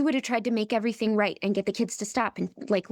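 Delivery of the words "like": ordinary talk, nothing marked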